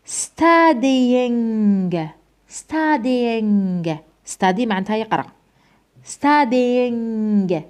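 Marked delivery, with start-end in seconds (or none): 0.1-4.0 studying stretched out, ringing "ng" ending with a released g; pronunciation demo. Shown as a demonstration. Teacher shows students how it is pronounced, drawn-out ng demo. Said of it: "شوف ركز معايا كيفاش راح ننطق هذه". 6.1-7.6 studying drawn out on a long "ng" with released g; pronunciation demo. Shown as a demonstration. Teacher repeats the word for students, drawn-out ng demo. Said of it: "شوف ركز معايا كيفاش راح ننطق هذه".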